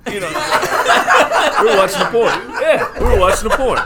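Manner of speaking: embarrassed giggle as you admit it; laughing confession. Real, not acted.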